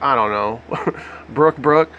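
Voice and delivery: ordinary talk, nothing marked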